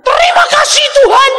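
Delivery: high, loud, laughing thanks; shrieking with relieved laughter; relieved laughing shout. Retold or imitated. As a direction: laugh out your thanks like a man just escaped. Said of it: "ketawanya itu kayak orang lolos dari cengkeraman setan".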